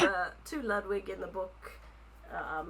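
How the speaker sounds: ordinary delivery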